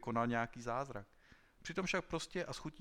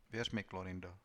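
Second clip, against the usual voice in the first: subdued, mildly grieved plea; sorrowful, not angry; 3 semitones lower than usual. A narrator's reading. Konos asking Klorindo to trust him, sorrowfully. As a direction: plead with sorrow rather than anger. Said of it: "spíše zármutkem než hněvem".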